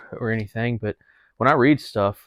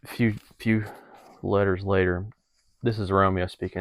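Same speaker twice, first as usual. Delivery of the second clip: normal talking voice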